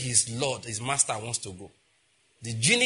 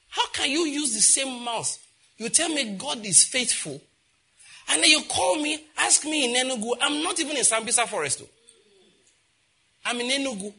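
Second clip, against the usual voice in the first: high, loud pulpit challenge; fiery preaching; 10 semitones higher, 3 dB louder than usual. Acted. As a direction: preach it hard at the congregation. Preaching at full force.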